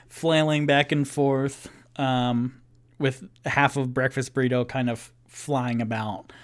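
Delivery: ordinary delivery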